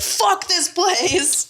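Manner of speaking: high, slow, drawn-out mock yell; theatrical high-pitched retold outburst; mock angry exit. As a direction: stretch it out high like a parting shot. Retelling storming out angrily.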